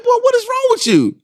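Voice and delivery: can't believe what he's seeing; exasperated. Retold or imitated. Dad picking up his crying son, exasperated.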